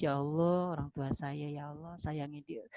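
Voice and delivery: soft, understated mock-weeping plea; acted tearful prayer. Convert begging Allah for their parents, softly acted tearful prayer. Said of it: "Ya, nangis".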